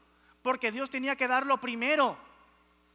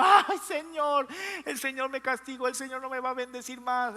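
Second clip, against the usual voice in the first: mock tearful wailing; 3 dB louder than usual. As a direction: imitate a whining believer lamenting to God. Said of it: "llega llorando y diciendo".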